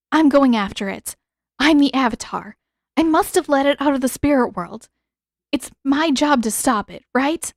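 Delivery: urgent resolve. Acted. Katara resolute, rushing off.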